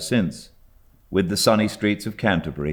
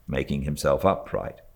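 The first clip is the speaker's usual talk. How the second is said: ordinary delivery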